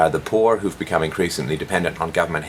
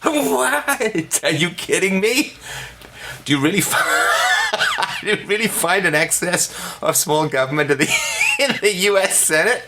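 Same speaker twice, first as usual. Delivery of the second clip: very high squeal, laughing, breaking up; shrieking laughing disbelief; incredulous laughing disbelief; 12 semitones higher than usual. Real, not acted. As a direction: react as if it's the most absurd thing ever. Incredulous mock reaction.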